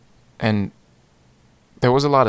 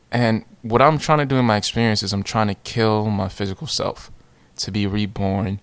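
ordinary delivery, nothing marked

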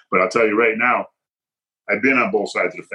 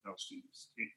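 whispered officer quote; 26 dB quieter, slower than usual. Retold or imitated. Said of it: "I'm like / officer quote".

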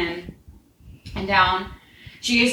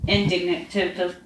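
ordinary delivery, nothing marked

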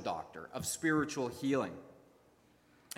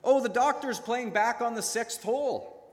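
breezy husband; 7 semitones higher, 4 dB louder than usual. Acted. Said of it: "he finally replied".

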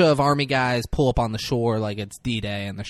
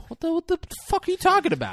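very high, fast incredulous outburst; mock indignant; much faster than usual. [name] baffled at being asked to track, mock indignation. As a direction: rush it out in squeaky disbelief.

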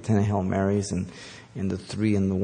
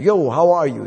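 mock chummy greeting, higher and louder; over-familiar friend; 6 semitones higher, 6 dB louder than usual. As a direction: greet God like a casual buddy, hammed up. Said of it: "treats God like a friend".

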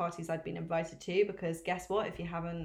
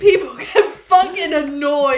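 laughing complaint; 6 semitones higher, 14 dB louder than usual. Real, not acted.

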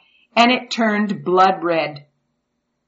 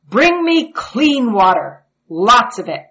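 commanding shout; 5 semitones higher, 7 dB louder than usual. Acted. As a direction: order the servants loudly. Pharaoh commanding servants.

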